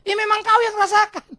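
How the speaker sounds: loud, high doctor's comeback; exasperated retort. Acted. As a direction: throw it back loudly at the patient. Acted doctor retorting to patient.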